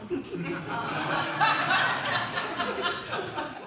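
a long, high, soft real laugh at his own made-up story; soft amused laughter, high and breathy. Real, not acted. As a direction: let a genuine laugh break out after the confession. Admits he invented the story.

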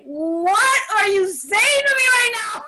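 high, loud, incredulous shout; overdramatic outburst. Retold or imitated. Speaker reading the big book, shouting in melodramatic disbelief. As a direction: wail it like a telenovela heroine. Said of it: "us Latinos are very dramatic".

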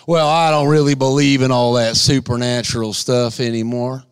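low, lazy doubter voice; mocking skeptic. Preacher mocking a skeptic, low and dismissive.

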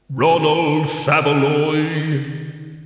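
very slow, echoing summons; voice of Death. A narrator's reading. Death calls the dead teacher by name, solemn. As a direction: intone the name like Death itself, drawn right out.

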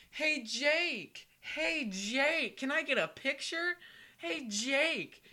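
high-pitched holler for a player's attention; eager fan calling. Retold or imitated. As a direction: pester a player from the stands. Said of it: "the guy was like".

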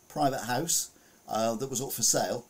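ordinary talk

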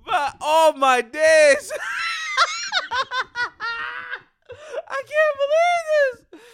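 can't stop laughing in disbelief; tearful laughing disbelief. Real, not acted. Laughing disbelief, near tears.

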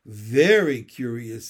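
slow, loud, weighty echo; drawn-out, sagely baffled; echoing courtiers. A narrator's reading. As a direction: copy the king's puzzlement pompously. Courtiers echo the puzzled king.

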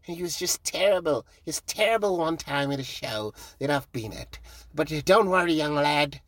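lightly put-on voice of a sports hero consoling a youngster; John Robbie. Hero consoles comic who died onstage, lightly imitated reassurance. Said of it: "John Robbie came to me, he's like".

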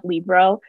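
"Libro" as Lee plus bro; two-syllable mispronunciation. Shown as a demonstration. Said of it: "the name Lee and then the word bro".

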